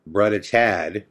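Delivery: slow, drawn-out "Brother Chad" in a put-on little-sister voice; slowly calling "Brother Chad" in a different voice; imitating Katie. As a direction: stretch out "Brother Chad" the way she calls him. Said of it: "that was her way of saying".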